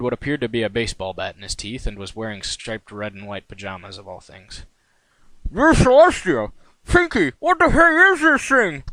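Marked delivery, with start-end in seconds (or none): 5.5-8.8 very high, loud cartoon pony yelling round a mouthful; startled stallion shouting through a bat; muffled pony shout. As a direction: call out with a bat clenched in the teeth. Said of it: "he called, uh, his voice a bit muffled by the bat in his mouth".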